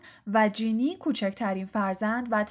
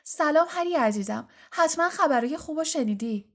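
higher, lively motherly welcome; affectionate greeting; 6 semitones higher than usual. A narrator's reading. Mother turning from her sons to their friend, affectionate. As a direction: greet him fondly and brightly.

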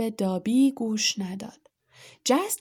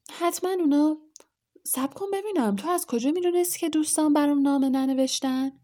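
sudden suspicion; 6 semitones higher, faster than usual. A narrator's reading. Harry suddenly suspicious of Dobby.

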